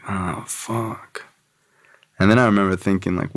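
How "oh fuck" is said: soft, hushed dismayed curse under the breath; quiet oh no moment; muttered dismay. Retold or imitated. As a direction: let it slip out quietly, sinking feeling. Dismayed realization, no knife.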